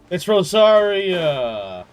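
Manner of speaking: loud, high, slow delight; loud, drawn-out excited cry. Real, not acted. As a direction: call out her name with joy. Recognizing favorite character.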